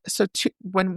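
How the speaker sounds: ordinary talk, nothing marked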